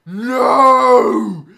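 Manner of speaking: loud, high, anguished monster wail; pained golem cry. Acted. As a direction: howl it like a burned brute. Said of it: "the flesh golem was".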